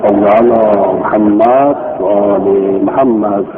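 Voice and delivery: slow, low devotional recitation of the salawat; low, drawn-out chanted blessing; religious blessing. Real, not acted. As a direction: intone it like a prayer opening. Opening blessing before the lesson.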